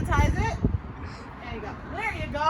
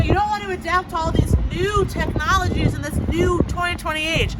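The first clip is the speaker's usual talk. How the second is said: usual speaking voice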